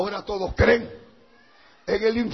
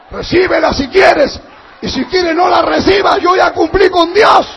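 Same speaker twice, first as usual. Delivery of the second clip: high, loud declaration; full-force pulpit cry; defiant preaching shout; 6 semitones higher, 10 dB louder than usual. Real, not acted. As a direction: shout it as a final disclaimer. Preacher disclaims responsibility loudly.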